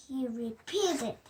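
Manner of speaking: plain reading voice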